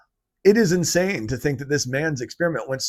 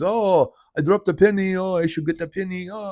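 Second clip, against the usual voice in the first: higher, hammed-up Yiddish New York accent; mock a Yiddish accent, pitched up; 6 semitones higher than usual. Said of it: "spoke with a Yiddish accent".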